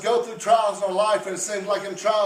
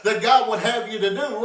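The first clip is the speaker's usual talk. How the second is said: normal talking voice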